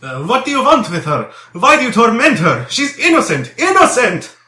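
loud, high anguished protest; shouting. A narrator's reading. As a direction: cry out in desperate protest, rising to a shout. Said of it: "was shouting at them".

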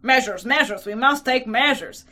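loud, insistent demand; a touch of seething exasperation. A narrator's reading. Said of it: "had begun seething terribly".

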